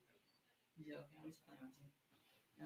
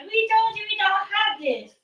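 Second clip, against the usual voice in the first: high, loud, drawn-out mimicry; mocking imitation; 12 semitones higher, 5 dB louder, slower than usual. Customer quoting drive-thru worker, mockingly high and loud. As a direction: mimic them in a slow, high mocking whine.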